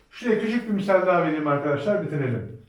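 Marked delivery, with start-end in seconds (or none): none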